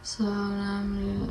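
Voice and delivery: slow, drowsy, half-asleep mumble; tired mumble. Retold or imitated. Imitating how she sounded worn out on camera. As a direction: let the words sag out exhausted. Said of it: "I was literally talking like".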